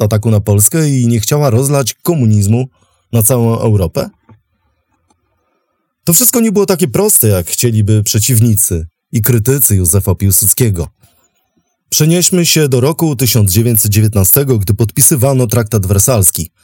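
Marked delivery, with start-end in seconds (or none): none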